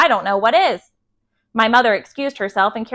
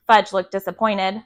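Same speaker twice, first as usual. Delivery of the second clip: plain reading voice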